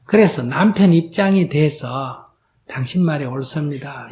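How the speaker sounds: ordinary talk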